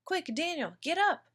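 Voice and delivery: high, urgent wake-up call; urgent alarm. A narrator's reading. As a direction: rouse the boy fast, alarmed. Mother wakes son during fire.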